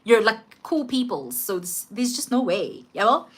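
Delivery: soft, gentle comfort to a friend; calm them down quietly; lightly voiced friend reassuring softly. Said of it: "Halo's busy reassuring me".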